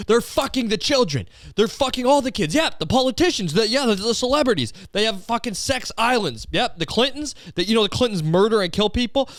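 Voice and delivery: very high-pitched conspiracy rant; frantic, hammed-up outrage; mock tirade. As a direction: act out a worked-up conspiracy ranter. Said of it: "go on this tirade".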